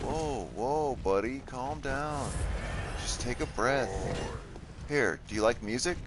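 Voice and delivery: high, slow coaxing voice; high gentle placating tone; soothing an animal. Acted. Calming an enemy.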